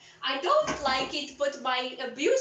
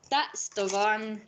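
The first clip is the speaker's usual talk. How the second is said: ordinary delivery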